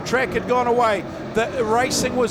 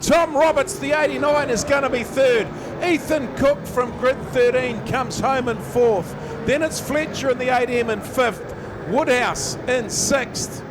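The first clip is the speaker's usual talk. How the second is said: motorsport commentator; 3 semitones higher than usual. Real, not acted. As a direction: shout the last lap home. Finish line live call.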